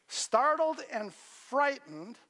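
drawn out very slowly, each word weighed; slow, deliberate repeat of Startled and frightened; slow dramatic emphasis. Acted. Preacher repeats key words.

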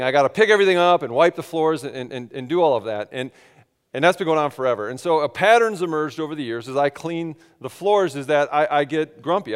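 usual speaking voice

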